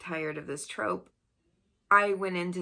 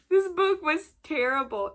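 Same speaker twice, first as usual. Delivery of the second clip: laughing; 8 semitones higher, 5 dB louder than usual. Real, not acted. Still laughing at the book.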